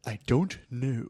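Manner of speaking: lightly mocked Shakespearean accent; a put-on made-up thespian accent. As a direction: imitate an odd stagey British accent. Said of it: "He was very Shakespearean".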